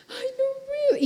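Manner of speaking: high tearful whimper of a worn-out student; imitating crying. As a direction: let it break into a little sob. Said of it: "como llorando".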